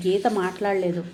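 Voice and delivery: ordinary delivery, nothing marked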